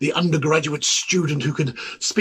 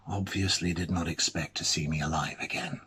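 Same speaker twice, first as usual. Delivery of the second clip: grim whisper; 8 semitones lower, 11 dB quieter than usual. Acted. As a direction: whisper it, barely voiced. Grim realization about the president.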